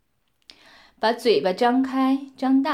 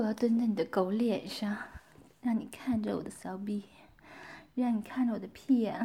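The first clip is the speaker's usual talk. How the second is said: whispered taunt; 3 semitones lower, 8 dB quieter than usual. Acted.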